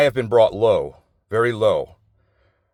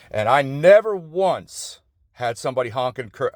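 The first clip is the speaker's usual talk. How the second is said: normal talking voice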